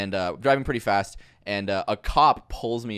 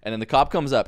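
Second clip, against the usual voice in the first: normal talking voice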